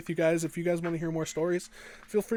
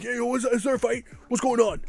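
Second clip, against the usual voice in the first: higher, quick mock adult voice asking about a fight; high quizzical teacher; 7 semitones higher, 3 dB louder, faster than usual. Teacher checking on the kids, asking in a high, quick voice. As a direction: play the nosy teacher, pitched up and fast.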